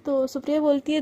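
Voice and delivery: ordinary delivery, nothing marked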